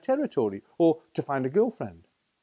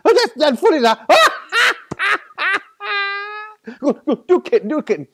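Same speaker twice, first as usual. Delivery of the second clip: high, loud taunting through giggles; jeering laughter; 11 dB louder, much faster than usual. A narrator's reading. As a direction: tease him while laughing hard. Other tigers jeer and laugh.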